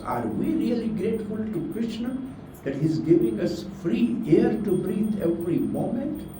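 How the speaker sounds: normal talking voice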